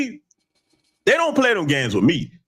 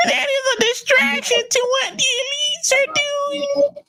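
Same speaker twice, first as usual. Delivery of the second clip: high, slow, whimpering mock voice; mock him in a high teary whine; pitched way up, dragged out and crying in mockery; punk bitch fleeing voice; slower than usual. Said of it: "read it in a little punk bitch fleeing voice".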